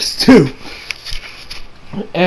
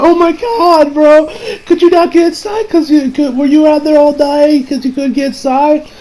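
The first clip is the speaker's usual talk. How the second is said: higher, fussing wife voice; worried wife; 8 semitones higher than usual. Acted. Wife fussing over the cat, higher voice.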